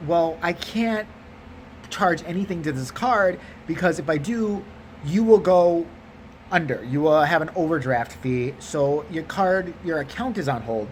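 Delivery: slow, halting bad-news delivery; hesitant teller. Teller explaining a card is on hold, slow and hesitant. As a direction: drag it out word by word as the teller.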